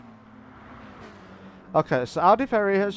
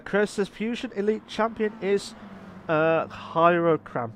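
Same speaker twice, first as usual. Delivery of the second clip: ordinary talk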